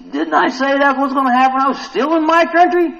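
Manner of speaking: high, loud protest; hammed-up aggrieved questioning; Jonah's indignant complaint. Preacher plays Jonah talking to God, high indignant complaint. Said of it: "He's talking to God".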